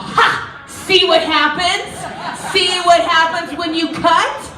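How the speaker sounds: loud, high triumphant gloat. Retold or imitated. Jenny gloating at the cutter, loud and high. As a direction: rub it in, crowing. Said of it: "I'm all".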